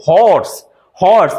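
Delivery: হর্স with a clear h at the start; teacher sounds হর্স slowly, stressing the h; pronounced horse. Shown as a demonstration. English teacher modelling হর্স with a voiced h. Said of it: "হর্স উচ্চারণ হচ্ছে".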